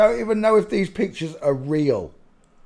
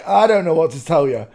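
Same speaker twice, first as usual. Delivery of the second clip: ordinary talk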